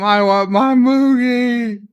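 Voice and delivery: put-on high, slow childish whimper; whining for Moogie. Rom begs his captors to go home, whimpering. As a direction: plead like a homesick little boy.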